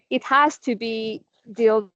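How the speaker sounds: ordinary delivery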